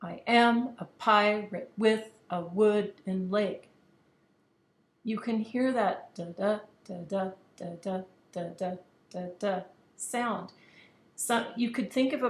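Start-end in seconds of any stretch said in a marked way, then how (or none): 0.0-3.7 slow, loud beats on "AM", "PI", "WITH", "WOOD", "LEG"; "I am a pirate with a wooden leg" chanted weak-STRONG, slowly; over-exaggerated iambic stress. Shown as a demonstration. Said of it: "I'm going to over-exaggerate". 6.1-10.5 galloping "da DA, da DA" beats; weak-strong pairs of "da da", evenly spaced; iambic rhythm. Shown as a demonstration. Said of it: "it matches the unstressed and stressed syllables".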